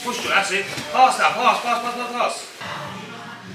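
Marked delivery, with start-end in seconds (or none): none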